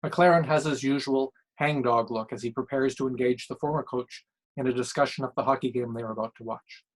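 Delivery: plain reading voice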